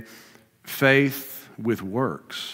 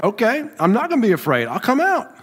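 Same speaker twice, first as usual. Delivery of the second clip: higher and quick, eager mock Peter; 10 semitones higher, 4 dB louder than usual. Peter answering Jesus from the boat, eager and higher. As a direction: play Peter jumping at the chance. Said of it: "Peter's like".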